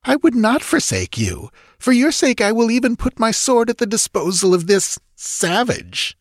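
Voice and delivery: high-pitched pledge, hurt pride; wounded loyal nobleman. A narrator's reading. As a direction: swear loyalty while swallowing an insult. Hurt count pledges his sword.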